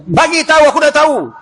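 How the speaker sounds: high, loud demand; insistent demand. Acted. Umar insists on being told, loud demand. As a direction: demand the answer. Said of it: "Abu Darda kata".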